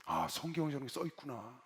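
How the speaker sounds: low, soft musing to oneself; quiet realization. Retold or imitated. Newcomer discovering what the Bible says, quiet realization. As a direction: murmur it as a private discovery. Retold private realization while listening.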